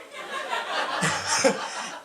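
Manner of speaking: airy laugh high up; real laugh; high breathless laugh. Real, not acted. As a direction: crack up. Laughing at the kid's question.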